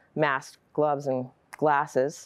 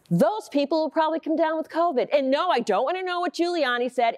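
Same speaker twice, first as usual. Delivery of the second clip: higher-pitched, fed-up telling-off; exasperated scolding; 8 semitones higher than usual. Acted. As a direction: lecture a husband who won't listen. Wife scolds husband about Trump news.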